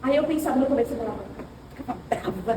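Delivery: usual speaking voice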